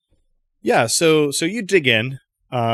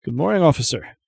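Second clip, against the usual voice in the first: shopkeeper voice; 4 semitones higher, 4 dB louder, faster than usual. Acted. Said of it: "Brock Choi will ... say".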